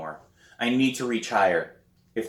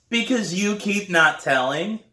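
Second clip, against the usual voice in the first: put-on high, cheeky kid retort; little brother voice; 9 semitones higher, 4 dB louder than usual. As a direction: talk back like a bratty little boy. Brian answers back.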